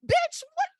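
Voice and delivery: high, loud incredulous blurt; stunned outburst. Real, not acted. Podcaster reacting to a dumb plot choice, high loud disbelief. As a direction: leap up in sheer disbelief.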